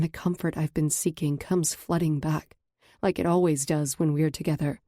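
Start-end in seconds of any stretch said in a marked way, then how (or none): none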